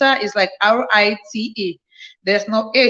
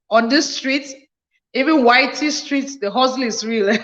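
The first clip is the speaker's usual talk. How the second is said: ordinary talk, nothing marked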